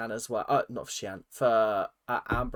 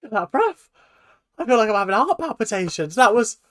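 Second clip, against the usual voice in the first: breathless excitement; 7 semitones higher, 7 dB louder than usual. Real, not acted. Reacting to a shocking TV moment.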